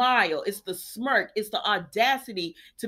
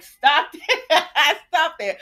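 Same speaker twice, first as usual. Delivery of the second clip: loud, higher laugh through the words; 6 semitones higher, 7 dB louder, slower than usual. Real, not acted. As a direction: crack up while saying it. Laughing at Sheila's line.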